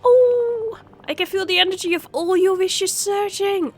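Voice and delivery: high, loud tearful joy; overjoyed cry. Acted. Sprite overwhelmed by the wishes, high joyful cry. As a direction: cry out as the energy surges. Sprite feels wish energy.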